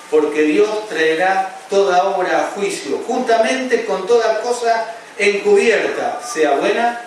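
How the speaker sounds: loud, slow, high-pitched pulpit cry; fiery preaching. Acted. Preacher warning the congregation of God's judgment, fiery preaching. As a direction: thunder the warning of judgment slowly.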